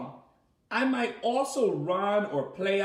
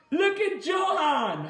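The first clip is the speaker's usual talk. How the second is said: high, loud, slow delighted call; excited greeting; 9 semitones higher, 4 dB louder, slower than usual. Real, not acted. Teacher greeting a child who appears on camera, delighted and loud. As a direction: call out happily to the little one.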